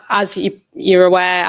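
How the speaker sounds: normal talking voice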